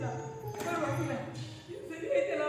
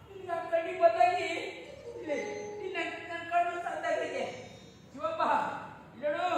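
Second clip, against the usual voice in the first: ordinary delivery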